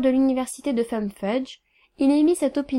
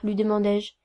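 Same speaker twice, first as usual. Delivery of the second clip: ordinary delivery, nothing marked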